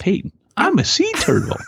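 very high, squeaky little voice; high mock child voice; tiny squeaky kid. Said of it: "and it says".

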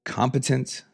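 ordinary talk, nothing marked

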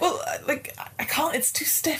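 high strained whisper; right in your ear. Acted. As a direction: whisper it, close and urgent. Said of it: "she's like".

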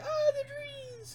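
high, drawn-out mock wail; mock crying. Co-host imitating little kids crying over burning trees, mock wailing. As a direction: wail it slowly as hammed-up crying. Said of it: "like, crying".

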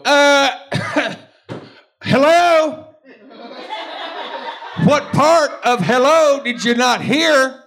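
high, loud sassy hello; Texan hello. Retold or imitated. Imitating how Texans say hello, loud and sassy. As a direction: snap the hello like they're insulted. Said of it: "they go".

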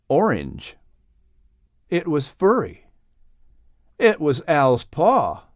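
plain reading voice

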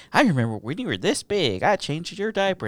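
high-pitched gushing relative act; imitated mom's friends. Mom's friends to a kid, gushing in imitation. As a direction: coo it like a family friend.

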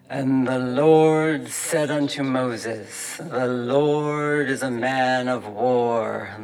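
slow, loud, booming sermon voice; the exhorter roars scripture through the church wall; bellowing preacher. Acted. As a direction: bellow it from the pulpit, dragging each word. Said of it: "when they bellowed".

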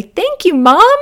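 high, loud burst of gratitude; gleeful mock kid exclamation; excited thanks. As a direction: imitate a child jumping on mom with thanks. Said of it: "jump on me and say".

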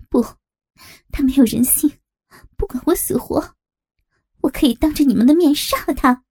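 high, bitter outburst; vehement, through clenched teeth. Acted. Captive swears hatred of the accused man, vehement outburst. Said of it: "文杰咬着牙回应着".

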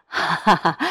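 loud acted belly laugh; hearty laugh. Bank boss amused by her answer, loud laugh. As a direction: laugh out loud, pleased with yourself.

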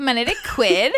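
put-on high valley-girl upspeak. Co-host echoing the Latin catchphrase, valley girl. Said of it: "doing Latin Valley Girl".

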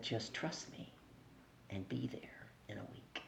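soft, near-whispered confidence; calm hushed assurance. Retold or imitated. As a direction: tell her quietly to trust you. Boss's calm firm assurance.

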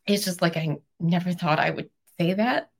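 ordinary delivery

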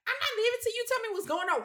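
high, fast whiny mimicry; mock stubborn wife. Mocking the wife's line to the husband in a high, fast whine. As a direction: rattle it off like a bratty teen.

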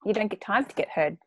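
ordinary talk

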